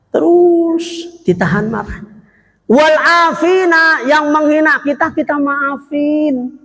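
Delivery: high, slow preaching cry; fervent sermon delivery. Acted. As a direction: lift the voice to preach. Preaching at full force.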